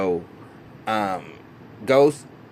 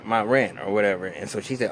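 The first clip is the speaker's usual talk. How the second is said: normal talking voice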